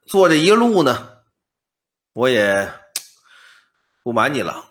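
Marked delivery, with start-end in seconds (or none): none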